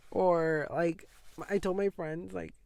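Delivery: usual speaking voice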